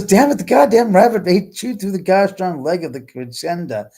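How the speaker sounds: high, loud mock rant as mom; mom's angry outburst. Mom yelling that the rabbit chewed the furniture, in a loud mock rant. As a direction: play an exasperated mom cursing the rabbit.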